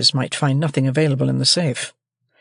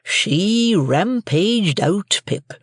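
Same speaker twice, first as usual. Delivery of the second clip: slow, higher, simple blacksmith; Joe's slow country voice; 6 semitones higher, much slower than usual. A narrator's reading. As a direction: Joe tells Pip, slow country drawl. Joe repeats to Pip.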